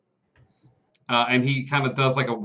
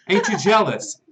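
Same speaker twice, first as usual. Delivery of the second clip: higher, sly guard showing off; guard's voice; 7 semitones higher than usual. Acted. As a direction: brag to your buddy in a higher voice. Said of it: "he looks at it and goes".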